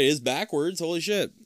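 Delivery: ordinary talk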